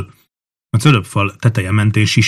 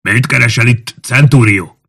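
raised, formal military challenge; shouted formal question; 3 semitones higher, 5 dB louder than usual. A narrator's reading. Officer on the wall challenging a fugitive centurion, calling formally. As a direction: call it out with stiff formality. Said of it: "kiáltotta Tullius".

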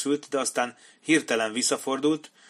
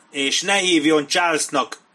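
loud, higher, indignant retort; angry outburst; 6 semitones higher, 8 dB louder than usual. A narrator's reading. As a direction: flare up at the familiarity. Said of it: "csattant fel Morris". (ingerülten felcsattanva)

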